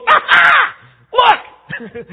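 very high, loud mock villain cackle; cartoonish gloating shout; gleeful Satan. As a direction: crow with hammed-up devilish glee. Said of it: "Satan goes".

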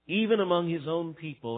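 ordinary talk

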